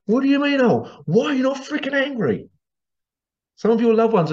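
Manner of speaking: high-pitched incredulous demand; indignant, disbelieving outburst; exasperated outrage. Acted. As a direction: challenge their indifference, pitch shooting up. Exasperated retort to indifferent people.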